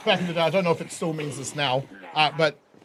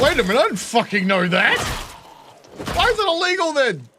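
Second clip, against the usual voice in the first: mock disbelief; 8 semitones higher, 5 dB louder than usual. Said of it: "'Cause you're like".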